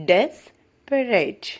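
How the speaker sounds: desperate said slowly with the weight on des; first syllable stressed. Shown as a demonstration. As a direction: drag desperate out, slamming des. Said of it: "des എന്നുള്ളത് stress ചെയ്യുക".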